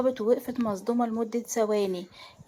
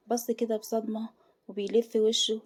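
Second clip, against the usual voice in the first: ordinary delivery, nothing marked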